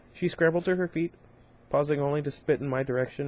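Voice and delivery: ordinary delivery, nothing marked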